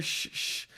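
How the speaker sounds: sharp voiceless "shh, shh"; imitated shushing. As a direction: shush him like an annoyed congregation. Congregation hushing the loud man.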